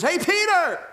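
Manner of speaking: high-pitched call across the water; yelling out. Acted. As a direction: call out to a friend far off. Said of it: "who yells out there and says".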